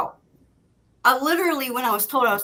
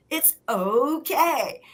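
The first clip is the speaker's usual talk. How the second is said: high, very slow, drawn-out reassurance; imitated reassurance; 6 semitones higher, much slower than usual. Husband telling panicking wife all is fine, imitated reassurance. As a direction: stretch it out to soothe, pitched high.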